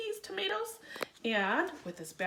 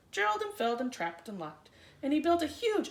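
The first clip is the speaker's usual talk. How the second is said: ordinary delivery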